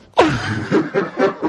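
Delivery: high cackling laugh; mocking villain cackle; evil laugh.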